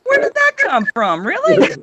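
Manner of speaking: high, squeaky mock astonishment; acted disbelief. Owner questioning the dog's sudden mess, high mock disbelief. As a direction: pitch climbing in baffled protest.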